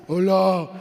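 low, loud booming hola; big booming voice. Retold or imitated. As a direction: boom it out like a big man. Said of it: "el chiquito tiene un vocerrón". (vozarrón grave y fuerte)

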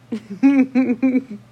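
loud, tickled giggle. Real, not acted. Picturing them in a karaoke contest, giggling. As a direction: burst out giggling at the idea. Laughs at own joke.

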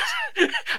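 very high squeaky burst of laughter; a giddy shriek of a laugh; squealing laugh. Real, not acted. Laughing at how cheap the pills were, high squeal.

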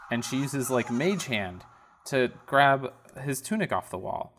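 usual speaking voice

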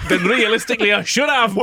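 high protest breaking into laughter; flustered guard. Acted. Guard excusing his scant information, flustered high voice. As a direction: finish the excuse high and amused.

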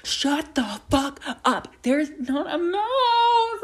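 high, slow, wailing disbelief; panicked denial. Retold or imitated. Girl retelling how she wailed at her mom about the mouse. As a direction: wail it like you can't believe it. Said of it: "And I'm like".

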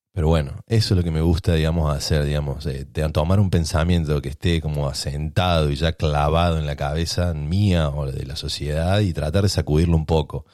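ordinary talk, nothing marked

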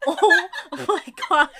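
loud, high, drawn-out through laughter; slow hammed-up gasp of disbelief, laughing; mock shock. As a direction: re-enact horror while cracking up. Retelling shock while laughing hard.

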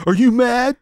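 high-pitched film-hero send-up; Keanu Reeves. Retold or imitated. Asking the partner if he's mad, Keanu impression. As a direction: goad your partner in a high mock voice. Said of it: "You do the Keanu lines".

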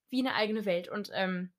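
ordinary delivery

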